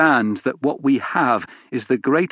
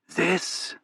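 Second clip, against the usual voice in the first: a dry, rasping, higher quiet "This?" with an odd lilt; dusty rasp; 7 semitones higher, 6 dB quieter than usual. Acted. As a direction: rasp out "This?" like a voice unused for years, softer. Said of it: "Her voice is like dust, just a rasp and a weird intonation".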